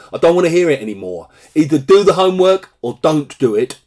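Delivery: fast, acted telling-off; exasperated teacher. Teacher to student about homework, exasperated telling-off. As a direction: cut off the excuses, quick and insistent.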